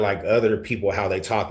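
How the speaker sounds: ordinary talk, nothing marked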